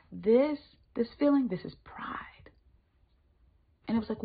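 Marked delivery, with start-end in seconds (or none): none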